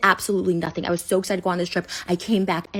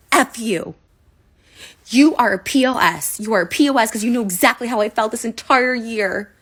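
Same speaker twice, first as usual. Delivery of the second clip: furious outburst; 3 semitones higher, 6 dB louder than usual. Real, not acted. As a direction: spit the insult at him.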